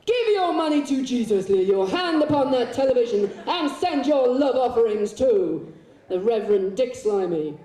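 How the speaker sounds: loud, high American televangelist; mock hard-sell preaching; born-again preacher. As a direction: whip up the congregation like a TV preacher. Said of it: "like they do, the American preachers, the born-again preachers".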